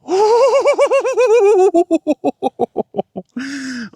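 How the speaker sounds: long, high burst of happy laughter; delighted high laugh. Real, not acted. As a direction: laugh out loud with joy. Just hit a great shot.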